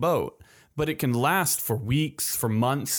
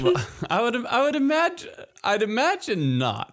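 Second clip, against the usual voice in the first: laughing; 10 semitones higher than usual. Real, not acted. Laughing reply.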